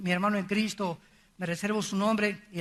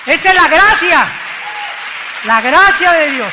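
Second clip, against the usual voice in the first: full-voiced preaching shout, much higher and much louder; shouted proclamation; 7 semitones higher, 16 dB louder than usual. Real, not acted. Preacher proclaiming grace to the congregation, shouting.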